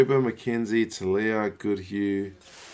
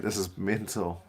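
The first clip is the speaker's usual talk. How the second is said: ordinary talk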